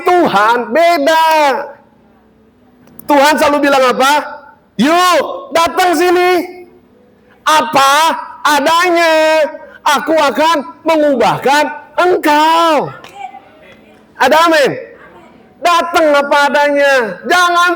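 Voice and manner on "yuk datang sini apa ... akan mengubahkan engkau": gentle inviting God. Acted. Voicing God's gentle invitation.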